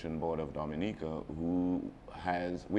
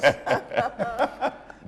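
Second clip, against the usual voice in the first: loud high laugh; 10 dB louder than usual. Real, not acted. Laughs at being called graduate.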